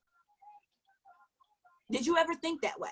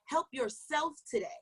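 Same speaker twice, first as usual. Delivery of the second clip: ordinary delivery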